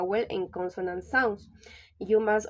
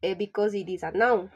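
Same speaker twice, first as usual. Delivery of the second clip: ordinary talk, nothing marked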